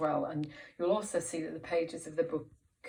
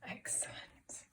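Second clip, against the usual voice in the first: whisper. Shown as a demonstration. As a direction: hush it to a whisper. Teacher whispering.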